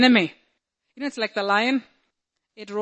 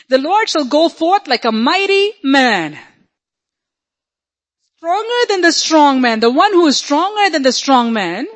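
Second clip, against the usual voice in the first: loud, higher declamation of scripture; full-force preaching; 5 semitones higher, 5 dB louder than usual. Acted. Preacher declaring a mighty warrior God to the congregation, full force. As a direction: proclaim it with swelling power.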